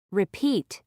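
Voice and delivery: "Repeat" with a puff of air on the p; "Repeat" with an aspirated p. Shown as a demonstration. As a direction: release the p in "Repeat" with extra breath. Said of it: "with an extra puff of air".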